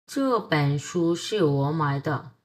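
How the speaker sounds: slow dictation. Shown as a demonstration. Teacher dictating slowly.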